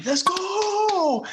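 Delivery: high, long-held dugout holler; retold yelling. Player yelling from the dugout, high drawn-out mock yell. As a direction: imitate a hyped yell, stretching GO way up high. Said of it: "you got me in the dugout yelling".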